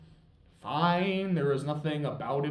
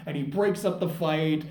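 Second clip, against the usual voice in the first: ordinary talk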